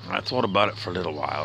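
ordinary delivery

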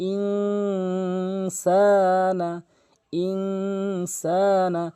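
ইনসানা stretched very slowly, the n held in the nose; nasalized. Shown as a demonstration. Teacher demonstrating ikhfa to students, slow nasal ইনসানা. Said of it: "নাকের ভিতর নিয়ে পড়বেন".

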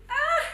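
high, loud cry of joy; joyful squeal. Real, not acted. Opening a luxury gift, squealing with joy. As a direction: let out a sudden happy shriek.

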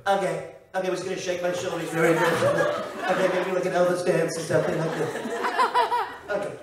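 cutesy Trump with little okays; Jimmy Fallon's Donald Trump. Acted. Comedian mimicking a host's Trump, cutesy. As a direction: play the president cute and fidgety. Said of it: "Jimmy Fallon will be more cute".

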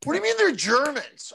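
high, indignant disbelief; incredulous. Retold or imitated. Comic confronting the club doorman, incredulous.

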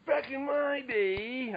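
put-on high, very slow creaky old voice; old person voice. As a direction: stretch it out like a frail elder. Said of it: "[name], you're up".

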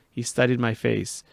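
plain reading voice

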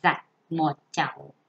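careful classroom reading; model reading aloud. Shown as a demonstration. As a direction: read it as an example to copy. Teacher models reading paragraph two.

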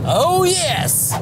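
triumphant shout. Real, not acted. Triumph after the top-speed run.

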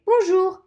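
teacher modelling "bonjour"; French pronunciation. Shown as a demonstration. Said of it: "In French, we say".

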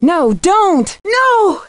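high panicked cry that rises to a scream on the last no; terrified plea. Acted. Nancy begging an attacker to stop, panicked high cry. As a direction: beg them to stop in sudden terror. Pleading as danger strikes.

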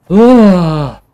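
loud high yelp of fright; real jump-scare shriek; startled scream. Real, not acted. Gamer jolted by something sudden, loud scream.

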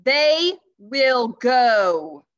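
high, very loud and very slow decree; booming. Acted. Christmas Knight decreeing before the court, booming. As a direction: boom it so it echoes. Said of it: "boomed the knight".

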